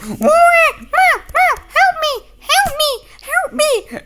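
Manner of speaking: extremely high, loud pretend meowing squeal; squealing cat. Playing a meowing cat begging for help, high squeal. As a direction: squeak it out like a tiny cat in trouble. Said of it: "I'm just like".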